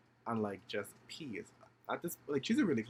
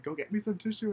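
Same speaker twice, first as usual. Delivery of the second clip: high, loud mimicked order; demanding; 11 semitones higher, 6 dB louder than usual. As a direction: imitate a friend calling out an order. Friend demands tissue while stuck.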